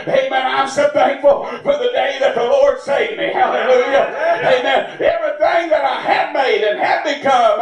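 usual speaking voice

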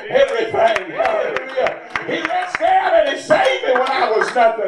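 ordinary talk

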